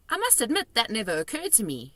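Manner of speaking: a hint of a young girl; Sophie's voice. A narrator's reading. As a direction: read her as a polite small girl.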